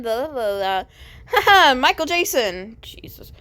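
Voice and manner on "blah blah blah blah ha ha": ordinary delivery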